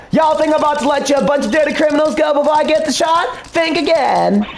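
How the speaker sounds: put-on southern accent. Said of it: "southern accent here".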